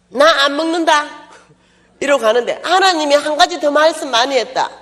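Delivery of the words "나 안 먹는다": loud sulky refusal; stubborn refusal. Retold or imitated. Preacher refusing her friend, loud sulky voice. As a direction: refuse like a sulking child.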